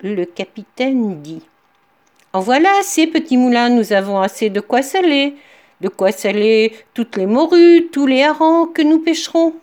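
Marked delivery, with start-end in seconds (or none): 2.3-9.6 loud command. Acted. Captain tries to stop the mill.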